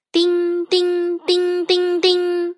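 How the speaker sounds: high monotone ding-ding alarm; alarm imitation. A narrator's reading. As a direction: ring out evenly like a fire bell. Narrator imitates fire alarm ringing. (高音模仿警铃声)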